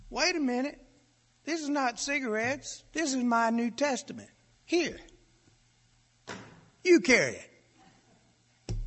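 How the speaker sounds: put-on high, small squeaky boy's voice, slowing at the end; squeaky little voice. Freshman boy offering his New Testament to the bully, squeaky. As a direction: pipe up small but firm, handing over the book. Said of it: "that little squeaky voice said".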